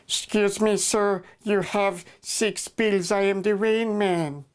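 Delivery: high, strange, slowed put-on voice; a stilted, oddly high know-it-all spectator; odd Rain Man voice. Heckler calling out the extra bill in a high, stilted put-on voice. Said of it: "I don't know why I talked like that".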